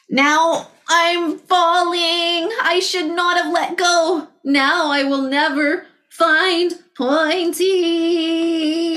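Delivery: very slow, high, sorry little dinosaur; dismayed wail. Acted. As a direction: lament the fall, drawn out. Tiny T-Rex falling.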